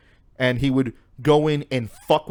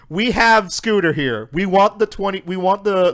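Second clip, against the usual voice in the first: raised, louder announcement of demands; mock ransom demand; 6 semitones higher, 5 dB louder than usual. Captors announcing Scooter to his enemy, loud acted demand. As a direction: declare it like a captor making terms.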